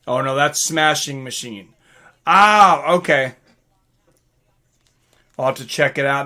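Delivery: ordinary talk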